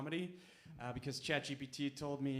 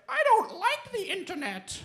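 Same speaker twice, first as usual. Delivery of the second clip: put-on very high, loud, slow wounded old-lady voice; a granny giving up on technology; upset grandma; 11 dB louder than usual. Grandma offended by Google's answer, high wounded old-lady voice. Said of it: "my grandma was like".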